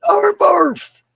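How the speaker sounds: very high, loud hoot of a name; calling out. Real, not acted. Host shouts out a favorite viewer, hooting high and loud. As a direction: whoop the name out.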